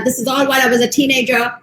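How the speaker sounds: ordinary talk, nothing marked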